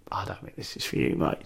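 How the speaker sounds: whispered, low imitation of the promoter; retold promoter, whispered. Promoter repeats the brush-off, now whispered and low. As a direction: breathe it out low like a blunt aside. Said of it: "he went".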